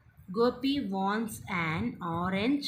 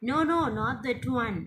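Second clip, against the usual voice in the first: quick, higher, mildly protesting story voice; insistent refusal; 4 semitones higher, 4 dB louder, faster than usual. Acted. Child in story refuses puppy.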